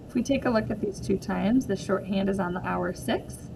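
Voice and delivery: usual speaking voice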